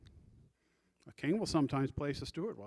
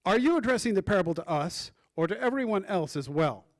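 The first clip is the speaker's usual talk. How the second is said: loud, higher-pitched question; chiming up; 4 semitones higher, 7 dB louder than usual. Acted. Preacher reads out Peter questioning Jesus, loud chime-in. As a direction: pipe up loudly with the question. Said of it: "Peter chimes up".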